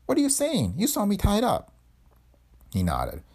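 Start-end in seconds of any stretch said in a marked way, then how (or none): 0.1-1.7 high-pitched startled woman. A narrator's reading. Young woman to suspicious Boyd, startled. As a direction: jump up in pitch, alarmed denial. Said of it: "She turned, startled".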